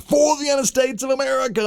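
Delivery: high, ringing cry of triumph; screaming out. Retold or imitated. Michael Jordan holding up the ball to the crowd, high triumphant yell. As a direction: belt it out like a champion at the Olympics. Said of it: "he's screaming out".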